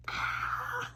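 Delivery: a high, breathless swoon sigh. Real, not acted. Reader swooning over a love quote, high sigh. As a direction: melt with a sigh.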